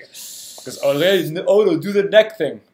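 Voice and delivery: a put-on voice, lightly done; a playful half-hearted impression; impersonation. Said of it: "That's my own impersonation".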